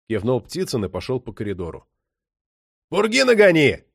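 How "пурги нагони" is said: loud, higher-pitched shout; raised parting call; calling after him. A narrator's reading. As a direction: holler it down the corridor. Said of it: "крикнул ему вслед".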